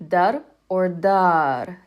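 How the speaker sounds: slow dar or dar, comparing the length of the ah; contrasting vowel length. Shown as a demonstration. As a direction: stretch the ah in one dar, not in the other. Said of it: "that elongated sound".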